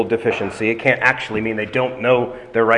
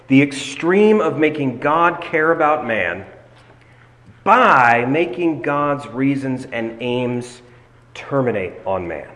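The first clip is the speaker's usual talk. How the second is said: measured teaching pace with pauses; slow, weighty explanation; slow and deliberate; slower than usual. Real, not acted. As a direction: spell out the error slowly, phrase by phrase. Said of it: "I'm gonna say this slowly".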